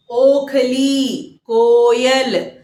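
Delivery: Gita drawn out very slowly, syllable by syllable; slow classroom enunciation; slow teaching demo. Shown as a demonstration. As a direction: spell out Gita slowly for children. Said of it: "इस तरह के शब्द बनेंगे".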